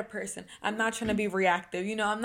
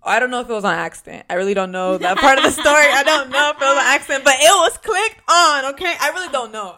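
loud, rapid insistence rising higher; emphatic protest; 5 semitones higher, 13 dB louder than usual. Real, not acted. Defending an uncertain detail of the story to a friend, loudly insistent. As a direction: protest loudly over the laughter.

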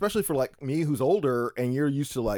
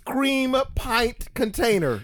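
slow disbelief; 5 semitones higher, slower than usual. Retold or imitated. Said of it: "And I'm like".

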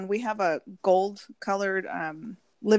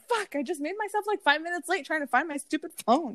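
higher, strained annoyed voice; hammed-up exasperation, higher; mock frustration; 7 semitones higher than usual. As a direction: curse yourself for being late. Retold frustration late for time.

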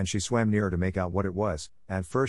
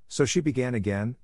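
usual speaking voice

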